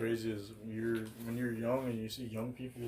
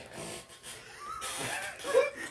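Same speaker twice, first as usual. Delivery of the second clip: big laugh. Real, not acted. Laughing at the Zam Daddy quote.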